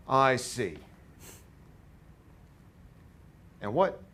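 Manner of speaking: put-on loud, thick New York accent. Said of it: "As thick of a New York accent as you can do".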